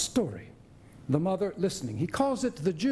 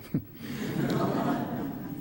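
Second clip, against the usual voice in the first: short breathy laugh; 5 semitones higher than usual. Real, not acted. Aside about unwritten scene.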